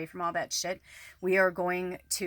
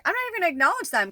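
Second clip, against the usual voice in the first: dismissive; 10 semitones higher, 6 dB louder, much faster than usual. Retold or imitated. Voicing her dismissive inner thought.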